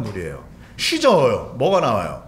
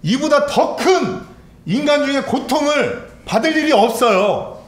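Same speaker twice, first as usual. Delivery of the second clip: loud, high, impassioned sermon delivery; urgent pulpit cry; fervent preaching; 8 semitones higher, 5 dB louder than usual. Acted. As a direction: preach the agony at full force. Preacher at full force.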